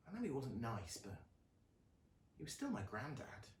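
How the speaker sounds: Luna confides to her rat, quiet sorrow; quiet grief. A narrator's reading.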